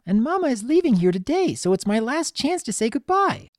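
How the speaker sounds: put-on high-pitched childish pleading; young pony Pinky. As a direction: plead like an upset little filly.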